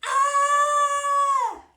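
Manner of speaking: loud high scream. Retold or imitated. Brother heard screaming from the maze, loud shriek. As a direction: let out a terrified shriek. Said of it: "we heard the scream again".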